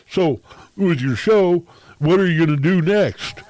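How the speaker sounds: mock condescending, high and loud. Mocking a friend who belittles him, high condescending voice. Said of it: "this condescending, belittling tone of voice".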